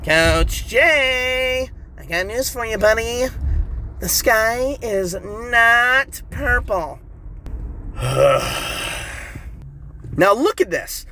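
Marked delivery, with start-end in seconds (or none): 0.0-7.0 a higher, skeptical second voice; mock heckler interrupting Coach Jay, slow and pointed; other-self voice. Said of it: "do you keep talking like that?". 7.2-9.5 unvoiced sigh. Alter ego sighs at coach.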